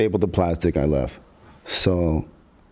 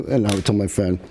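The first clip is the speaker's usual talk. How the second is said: ordinary talk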